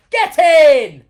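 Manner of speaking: big high shout of triumph; celebration shout. Shown as a demonstration. Coach demonstrates a goal-scoring yell for the viewers. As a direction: yell it like you've scored a goal. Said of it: "if you went like this".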